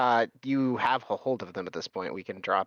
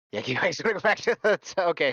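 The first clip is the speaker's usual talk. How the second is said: flustered rush; 7 semitones higher, 3 dB louder, faster than usual. Acted.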